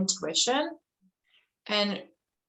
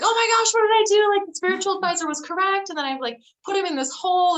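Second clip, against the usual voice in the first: much higher, loud panicked gasp of dismay; shocked realization; 12 semitones higher, 5 dB louder, faster than usual. Retold or imitated. King realizing his mistake, high loud alarmed dismay. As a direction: blurt it out in horror, then rush.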